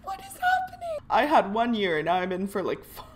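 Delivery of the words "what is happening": very high baffled squeak; shocked squeak. Real, not acted. Gamer squeaking at the longer sentence, very high. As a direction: squeak it in disbelief.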